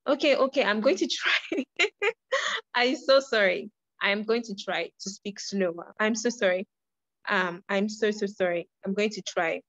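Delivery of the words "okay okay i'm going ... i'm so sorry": higher-pitched, real giggle breaking in; embarrassed laughing apology. Real, not acted. Teacher apologising to class for speaking fast, laughing. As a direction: laugh while apologising to the listeners. Flustered apology after being asked to slow.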